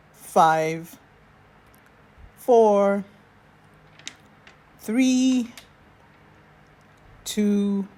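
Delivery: slow, loud count down with long pauses. Shown as a demonstration. Coach counting the held breath, slow and steady. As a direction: count each number out slowly. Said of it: "A count of seven to hold".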